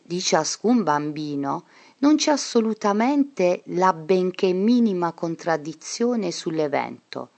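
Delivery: ordinary delivery, nothing marked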